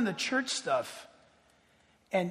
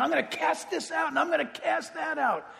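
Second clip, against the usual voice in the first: higher shouting mock bravado; mock demon-caster; 7 semitones higher than usual. Mocking Pentecostals who go around casting out demons, shouted. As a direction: lampoon the showy deliverance preacher. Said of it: "People walking around".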